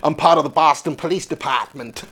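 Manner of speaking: put-on Boston accent. Claiming to be police, imitation Boston accent. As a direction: attempt a Boston cop's accent. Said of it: "like, from Boston".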